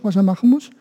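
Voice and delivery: usual speaking voice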